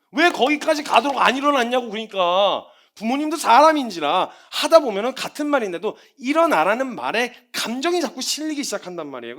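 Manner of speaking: ordinary delivery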